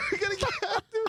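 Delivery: high-pitched mock hype through laughter; laughing re-enactment. Re-enacting the Zumba presentation for friends, laughing. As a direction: imitate your class pitch while cracking up. Acting himself dancing in class.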